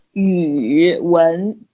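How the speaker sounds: drawn-out YU dipping low and rising before WEN; slowly nod YU down and up, then add WEN. Shown as a demonstration. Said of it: "nod it down and then you nod it up".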